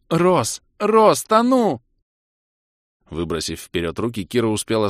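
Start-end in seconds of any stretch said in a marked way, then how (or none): 0.1-1.8 high, slow panicked wail; drowning cry. Acted. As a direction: cry out while going under. Drowning girl calls for help.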